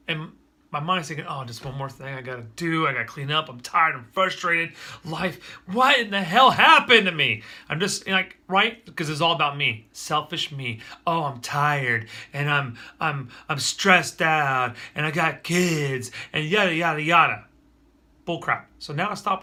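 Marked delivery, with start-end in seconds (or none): 1.3-7.4 self-pitying inner voice. Retold or imitated. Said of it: "woe is me stuff". 11.1-17.5 slow, louder, dragged-out self-pitying whine; mock woe is me. Dad mocking his own tired, stressed excuses, a slow whiny moan. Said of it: "woe is me stuff".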